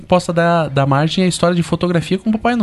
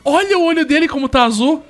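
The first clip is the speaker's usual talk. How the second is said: higher, playful mock innocence; teasing dad; 8 semitones higher than usual. Father teasing grandma about the blue eye, playful. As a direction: keep the joke going. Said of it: "Ele falou".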